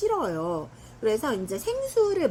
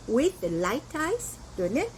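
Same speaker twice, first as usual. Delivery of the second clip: slow demo phrase; much slower than usual. Shown as a demonstration. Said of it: "이렇게 해요".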